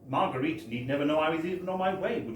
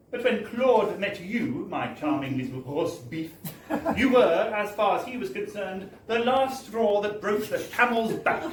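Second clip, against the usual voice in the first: mock clerk voice; 6 semitones higher, 3 dB louder than usual. Clerk's retold scolding continues.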